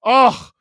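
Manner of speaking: loud, high "oh" at a near miss; sudden high outburst; loud exclaimed oh. Real, not acted. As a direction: cry out "oh" at the missed chance. Reacts to a missed chance.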